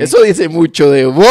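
loud, laughing jab; teasing laugh. Real, not acted. Host teases friend for killing plants, laughing loudly.

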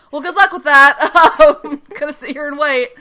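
loud, high sarcastic send-off with a laugh; laughing sarcasm. Retold or imitated. Ando sending Peter off alone, laughing sarcastic. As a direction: wave him off, amused.

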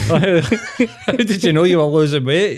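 laughing as he asks. Real, not acted. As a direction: question through real laughter. Laughs at his story.

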